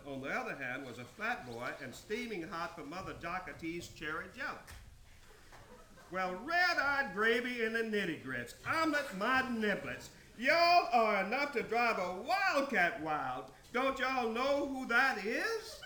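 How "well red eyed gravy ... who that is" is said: high, loud exasperated outburst; loud exasperation. Acted. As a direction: scold them all, voice raised high. Exasperated character scolds the group.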